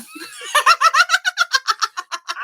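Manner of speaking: high shrieking laugh; real laughter at mom's denial; loud laughing fit. Real, not acted. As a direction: burst out laughing. Laughing at the story.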